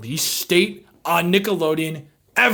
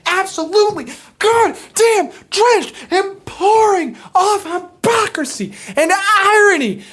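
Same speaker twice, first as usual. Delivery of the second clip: ecstatic rant; 10 semitones higher, 5 dB louder than usual. Real, not acted. Overwhelmed by favourite line.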